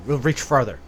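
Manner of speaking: ordinary delivery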